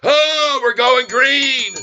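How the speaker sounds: high, loud, drawn-out whoop; excited cheer. Real, not acted. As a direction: holler it out as the price climbs. Cheering as stock turns green.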